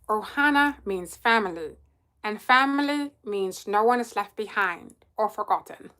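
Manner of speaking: low, odd little alien creature voice; Stitch. Acted. Streamer performs the movie line for viewers, alien creature voice. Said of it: "I'll do my Stitch voice".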